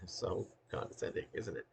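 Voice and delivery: hushed, breathy self-mocking whisper; whispered aside. Real, not acted. Speaker mocking his own tone, in a hushed whisper. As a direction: drop to a whisper to admit it.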